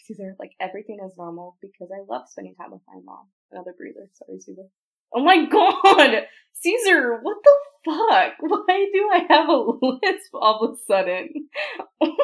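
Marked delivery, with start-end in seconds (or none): 5.2-12.2 loud shrieking laughter, slow; laughing. Real, not acted. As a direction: howl through it laughing. Shocked laughing outburst.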